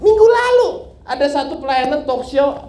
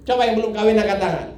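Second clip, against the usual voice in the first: usual speaking voice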